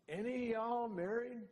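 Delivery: mock Texan boss, high and slow; Ross's drawl. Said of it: "then he goes".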